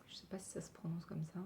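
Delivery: fast, voiceless whisper to herself; whispered aside. Real, not acted. Vlogger unsure of the polish name, quick whisper. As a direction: mutter the doubt under your breath.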